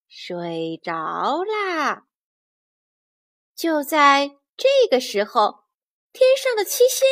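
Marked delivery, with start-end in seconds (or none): 0.1-2.1 low, soft, drawn-out words; drowsy slow. A narrator's reading. Narrator telling how the monkey dozed off, drowsy. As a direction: stretch it out as if drifting off. Narrating Wukong falling asleep.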